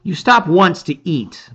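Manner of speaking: usual speaking voice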